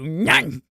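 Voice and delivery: a little puppy chomp sound "nyang"; mock the puppy biting; cute nipping noise; playful bite noise.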